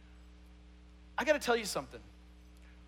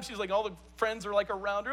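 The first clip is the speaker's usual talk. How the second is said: normal talking voice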